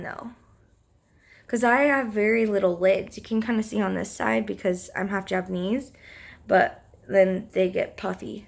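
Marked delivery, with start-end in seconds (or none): none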